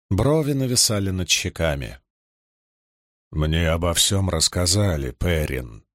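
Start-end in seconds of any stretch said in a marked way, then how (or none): 3.3-5.8 low, mournful rumble; sad boom. A narrator's reading. Loial the Ogier consoles Perin, sadly booming low. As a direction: drop deep and sound sorrowful. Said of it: "печально прогудел Агир".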